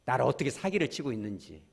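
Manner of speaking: usual speaking voice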